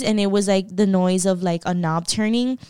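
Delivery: ordinary delivery, nothing marked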